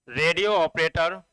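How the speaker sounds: ordinary delivery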